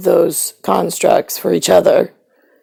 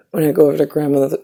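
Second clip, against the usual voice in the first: ordinary delivery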